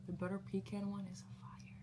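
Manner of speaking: hushed whisper. Real, not acted. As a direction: whisper it like a secret. Aside about Ensure.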